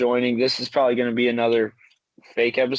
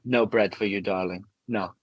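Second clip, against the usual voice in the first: lowered prissy artiste; 6 semitones lower, 3 dB quieter than usual. Retold or imitated. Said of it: "then he's like".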